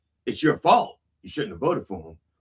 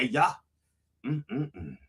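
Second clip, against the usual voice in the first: normal talking voice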